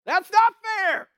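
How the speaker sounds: high, loud, slow outraged cry; loud, high shout of protest. Retold or imitated. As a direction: yell it from the back of the room. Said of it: "someone in the back of the room shouted".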